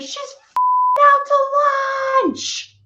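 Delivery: high, loud, slow sneering voice; mocking hothead. His mother sneering to her boss about the woman on the floor, scornful. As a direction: sneer it out, dismissing the victim. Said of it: "She goes".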